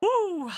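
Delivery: whooping in celebration; high victory whoop. Real, not acted. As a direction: let out a high woo. Celebrating the score.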